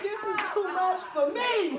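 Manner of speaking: high laughing admission. Retold or imitated. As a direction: laugh while admitting defeat. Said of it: "I said".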